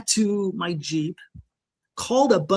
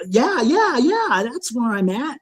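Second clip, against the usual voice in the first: higher, louder, rushed yeahs; mock-frantic agreement, pitched up; flustered agreeing; 5 semitones higher, 3 dB louder than usual. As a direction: blurt the yeahs out, half relieved. Shaken man answers on phone.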